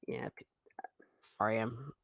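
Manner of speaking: dropped to a near whisper; a sudden hushed mumble to the chat. Real, not acted. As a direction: trail off under the breath. Voice drops to a hush.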